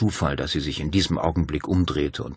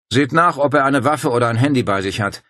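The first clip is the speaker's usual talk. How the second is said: a louder, higher barked command; commanding order; 7 semitones higher, 6 dB louder than usual. Acted. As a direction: order your men about. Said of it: "befahl Dario".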